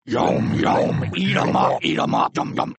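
rough, unvoiced monster growls; throaty creature chanting; chorus of evil toilets. A narrator's reading. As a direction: growl it like hungry creatures piling in. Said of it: "they cried".

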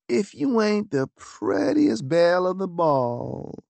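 put-on slow, drawn-out Southern belle accent, high; exaggerated southern accent. A narrator's reading. Lucy teasing Augusta in a hammed-up Southern accent. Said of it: "said Lucy in an exaggerated southern accent".

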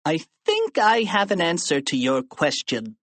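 put-on tattletale kid voice; Melvin Sneedly. A narrator's reading. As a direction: offer the answer like a teacher's pet. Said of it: "said Melvin Sneedly".

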